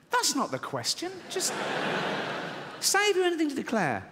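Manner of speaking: high, indignant, pompous correction; indignant pompous Wilde. Retold or imitated. Wilde indignantly correcting the customs officer.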